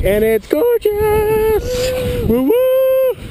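high excited cheer. Real, not acted. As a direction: gush over the view, way up high. Excited shout at view.

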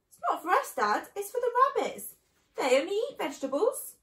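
put-on high, loud child's protest; pleading little fox. A narrator's reading. Smallest fox explaining to Dad, high-pitched pleading. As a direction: plead high and insistently like a youngster.

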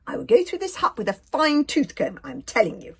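high, loud swaggering vow; boastful declaration. A narrator's reading. Kamikaze promising a thorough search of hut, boastful. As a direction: declare it grandly.

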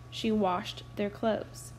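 ordinary delivery, nothing marked

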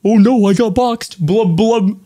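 high, gulping mock fish voice; goofy fish mouth, blub blub; fish impression. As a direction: play the boxed opponent as a flapping fish. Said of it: "looking like a fish going".

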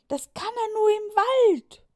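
put-on high, loud chorus of boys; boys shouting. Boys answering Juliane, high loud shout.

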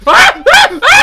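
terrified screams; screaming in fright. Real, not acted. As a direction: shriek in panic. Monster attack, screaming.

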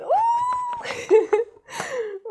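very high, loud delighted squeal breaking into laughter; excited squeal. Real, not acted. Vlogger seeing her longed-for gift, excited squeal. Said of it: "I'm so excited".

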